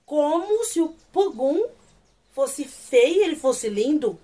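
slow, loud, emphatic indignation; indignant. Real, not acted. Fan defends Pogum against the insult, loudly indignant. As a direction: stretch each word in offended disbelief.

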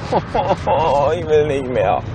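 very slow, worn-out sigh of a line; defeated. Real, not acted. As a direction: drag it out, completely done. Nach Lachen, erschöpft. (erschöpft und sehr langsam)

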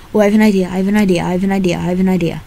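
ordinary talk

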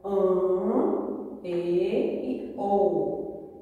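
AN, E, O held long with a closed sound; closed, drawn-out "an, e, o"; closed vowels. Shown as a demonstration. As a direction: pronounce each closed vowel slowly, drawn out. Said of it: "tenho que pronunciar".

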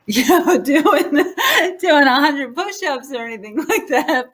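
laughs through the words, pitch up; chuckling as she admits it; laughing. Real, not acted. Admitting she skips push-ups, laughing.

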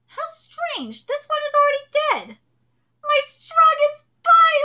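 high, dramatic character voice; lightly voiced anime cast; voiced manga characters. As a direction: act out the lines.

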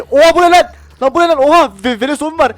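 high frantic yelling; panicked. Real, not acted. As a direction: run for your life, shouting. Fleeing zombies in game.

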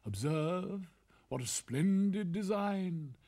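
slow gushing courtier voice; drawn-out grand praise for the emperor; pompous courtier. Acted. Officials praise the cloth.

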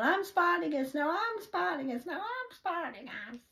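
high, loud mock Spartacus shout; rally the crowd like the film, hammed up; I'm Spartacus cry. Vlogger declaring herself the one, as a hammed-up Spartacus shout. Quoting the famous film line.

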